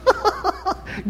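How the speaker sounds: a short high laugh. Real, not acted. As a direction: burst out laughing. Laughs at children's loophole.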